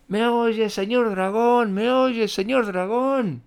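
high, strained call into his ear; shouting with all her might. Acted. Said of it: "Gritó con todas sus fuerzas". (grito agudo con todas sus fuerzas)

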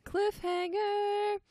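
high, slow, drawn-out cliffhanger; ending the story reading, dramatic drawn-out announcement; dramatic announcer. Acted. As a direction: announce it like a trailer voice.

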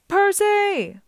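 high, loud, stretched-out holler; yelled name. A narrator's reading. Grover swoops in calling to Percy, yelling high. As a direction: holler it from far away. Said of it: "Grover yelled".

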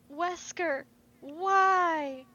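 high, drawn-out cry of betrayal; mock anguished plea. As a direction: wail it out in hammed-up despair. Betrayed dying woman's plea, retold.

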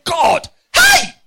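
sudden high shout at God; half-joking cry to heaven; mock shout. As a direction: yell it out in mock frustration. Preacher retells shouting at God.

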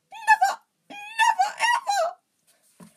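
very high, loud tearful elf; wailing. A narrator's reading. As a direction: wail each word in falsetto. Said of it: "he wailed".

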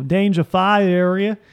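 "danger fire area" slow, syllable by syllable; every syllable pronounced. Shown as a demonstration. Friend mimicking Californians, "danger fire area" with every syllable sounded. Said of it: "every single syllable".

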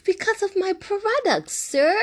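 playful high-pitched brag; sassy high reply. Retold or imitated. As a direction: answer him in a prissy high voice. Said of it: "I'm like".

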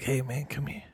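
hushed, furtive call to a passer-by; shady dealer. Acted. Plays dealer in dark alley.

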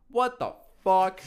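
loud, slow, high angry shout from downstairs; drawn-out shocked yell; retold dad yelling. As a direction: bellow it like a furious dad.

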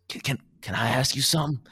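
Drama impression. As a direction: stammer it out eagerly as Drama. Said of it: "Drama goes".

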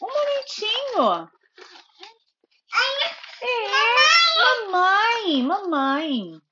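ordinary talk, nothing marked

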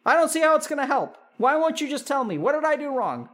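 put-on mother guilt-tripping her adult child; wounded parent. Acted parent whining.

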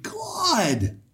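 very high, drawn-out delighted squeal; real astonished delight; thrilled amazement. Real, not acted. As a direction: react in high-pitched, stretched-out awe. Delighted reaction to the word's definition.

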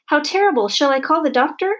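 high-pitched, distressed mother; wailing alarm. A narrator's reading. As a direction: fret aloud in horror. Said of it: "wailed Missus Semicolon".

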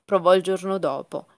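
ordinary delivery, nothing marked

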